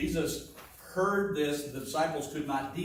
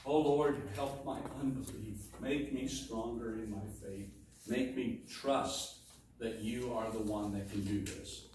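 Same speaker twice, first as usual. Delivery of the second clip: soft, pleading prayer, much softer; hushed prayer; 5 semitones lower, 7 dB quieter than usual. Acted. A father quietly begging the Lord for stronger faith, hushed and pleading. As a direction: pray it quietly, humbly begging.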